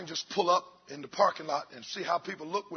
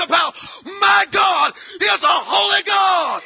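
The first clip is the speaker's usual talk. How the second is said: shouted, louder and higher sermon climax; full-force preaching; 10 semitones higher, 9 dB louder than usual. Acted. Pastor rousing his church, shouting at full force. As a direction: hammer it home to the congregation.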